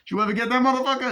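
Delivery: high, loud, fast hammed-up tough guy; mock gangster yell. Acted gangster dialogue from movie.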